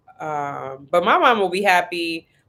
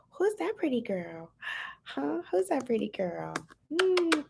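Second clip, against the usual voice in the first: higher, soft cooing; 9 semitones higher, 7 dB quieter than usual. Real, not acted. Mom cooing softly at her baby daughter.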